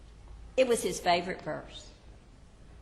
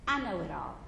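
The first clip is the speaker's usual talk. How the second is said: softer, lower gentle reply; Jesus answering the worried believer softly; gentle reassurance; 3 semitones lower, 4 dB quieter, faster than usual. Acted. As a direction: soothe him quietly.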